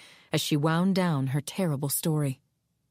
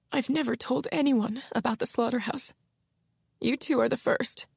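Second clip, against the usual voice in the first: tearful Ilona; 6 semitones higher than usual. Acted.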